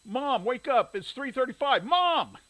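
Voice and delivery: loud high yelling in a panic; frantic shout. A narrator's reading. Boy yelling to wake his sleeping mother, panicked shout. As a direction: yell her awake, they're late.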